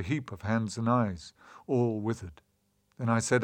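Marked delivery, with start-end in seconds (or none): none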